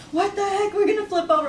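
high alarmed sister voice; sister panicking. Retold or imitated. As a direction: squeal it like the car will flip. Sister panics in sliding car.

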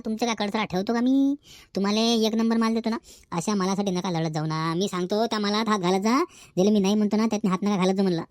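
put-on a quiet secret word to an old regular, low and soft; hushed aside. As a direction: lean in and murmur so the new customer can't overhear. Seller's private aside to old buyer.

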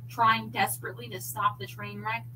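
plain reading voice

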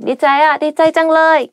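high, loud, quick burst of delight; natural happy exclamation. Shown as a demonstration. Teacher showing how happiness naturally sounds, excited. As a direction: exclaim it as in everyday happy talk. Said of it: "naturally like ... like that".

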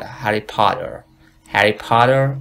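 "هری پاتر" with open ah and sounded final r; American "Harry Potter". Shown as a demonstration. Teacher contrasting accents, American r-ful "پاتر". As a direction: say "پاتر" as "pah-ter", r held. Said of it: "امریکن".

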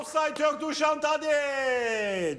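goal call held long and high; screaming football commentator. Real, not acted. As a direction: stretch out the scorer's name. Goal call after offside check.

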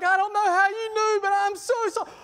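very high, loud blubbering imitation; mock tearful confession. Girlfriend confessing to her boyfriend, hammed-up crying. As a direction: wail the apology in a hammed-up high voice. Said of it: "She started crying".

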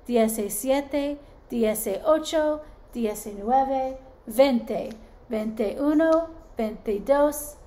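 put-on sing-song Spanish counting for kids; teacher counting to veintiséis in Spanish. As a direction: count along in Spanish with the class.

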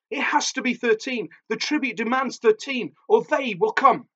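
high, urgent warning; frantic shrieking. A narrator's reading. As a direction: insist on it in a high, panicked voice. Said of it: "she shrieked".

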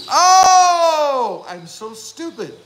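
fake-whine at your own mistake; mock wail. Mock self-scolding after misreading.

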